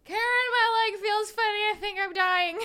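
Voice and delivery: high, loud mock panic; dad crying to wife Karen over FaceTime, hammed-up panic; panicky dad. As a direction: whine like you're dying.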